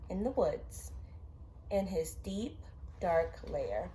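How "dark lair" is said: plain reading voice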